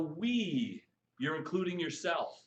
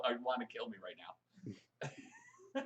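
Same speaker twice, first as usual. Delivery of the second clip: mock exasperation; 4 semitones lower, 9 dB quieter, much slower than usual. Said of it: "My kids are going".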